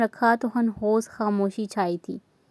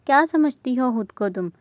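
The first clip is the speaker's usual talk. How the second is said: ordinary delivery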